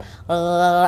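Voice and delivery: low, soft throaty gurgle; imitated gargle. Shown as a demonstration. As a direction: roll water noises in the throat. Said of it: "ah, kayak gitu tuh".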